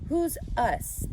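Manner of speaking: a father's quick puzzled question; Mr. Arable's voice. Acted. Father asking his daughter at breakfast, puzzled.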